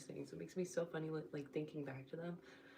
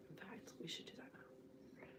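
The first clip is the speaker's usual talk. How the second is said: breathy hushed aside; whispering; 3 semitones higher, 14 dB quieter than usual. Real, not acted. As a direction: drop to a whisper. Sudden whisper to camera.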